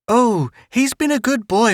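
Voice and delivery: a hint of a fussing old aunt; aunt reporting to the father. Aunt voiced in story.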